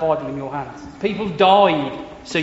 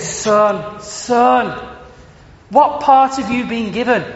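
a mum thrilled for her son, voice lifted; excited Scottish mum; 5 semitones higher, slower than usual. Retold or imitated. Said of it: "she said".

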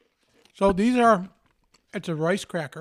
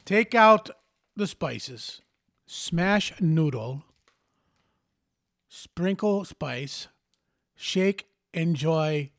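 deliberate, drawn-out instructions; slow reading; much slower than usual. Shown as a demonstration. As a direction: read each step very slowly. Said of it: "reading steps slowly".

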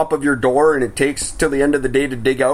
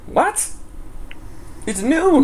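high-pitched incredulous protest; mock astonishment, pitched way up; baffled disbelief; 14 semitones higher, much slower than usual. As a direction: react as a stunned customer. Acted customer baffled at closure.

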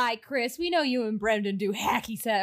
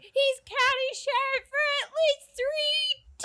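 put-on high, earnest girlish protest; Betty voice. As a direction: squeak it up high and naive. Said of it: "she's like".

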